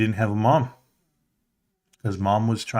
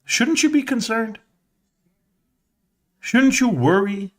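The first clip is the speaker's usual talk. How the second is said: incredulous question; 11 semitones higher than usual. Retold or imitated. Questioning his father's concern.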